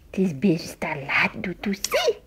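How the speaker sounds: soft whispered aside. Real, not acted. Mother whispering after she coughed from the kisses. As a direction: breathe the words without voice.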